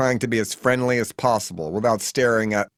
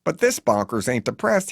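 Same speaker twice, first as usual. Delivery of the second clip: ordinary delivery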